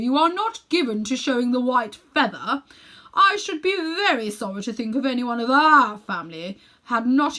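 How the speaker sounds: high and mighty uncle voice. Acted. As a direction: lecture him grandly. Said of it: "in a very high and mighty voice".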